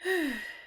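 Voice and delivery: short falling sigh of relief; relieved sigh. Acted. Reader as the danger passes and chest deflates, relieved sigh. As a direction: let the breath drop out in relief.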